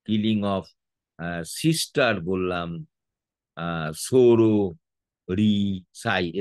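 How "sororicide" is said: "sororicide" very slow, as soro, ri, cide; low, drawn-out parts in "sororicide" with gaps between; syllable by syllable. Shown as a demonstration. Teacher recapping the three syllables, "sororicide" said syllable by syllable. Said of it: "এটা তিনটে".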